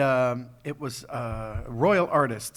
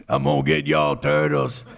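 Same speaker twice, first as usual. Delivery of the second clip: loud Cajun gator growl; Cajun alligator; 6 dB louder than usual. Retold or imitated. Alligator villain threatening the turtles, in a Cajun growl. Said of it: "it was a Cajun alligator".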